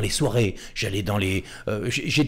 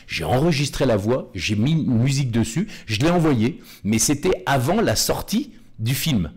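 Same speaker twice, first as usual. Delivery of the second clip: ordinary talk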